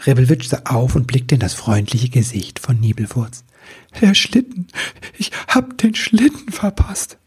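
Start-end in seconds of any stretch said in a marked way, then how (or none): 4.0-7.2 high, whimpering acted lament; tearful sobbing. A narrator's reading. Elf telling friend he missed the sleigh, acted sobbing. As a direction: wail it through mock tears. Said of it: "Er schluchzte".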